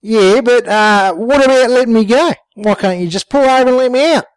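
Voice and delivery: high, indignant bogan woman; high-voiced woman protesting to Jimbo in the car; Sheila's voice. A narrator's reading. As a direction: pitch up into Sheila, outraged. Sheila pleading to be let out.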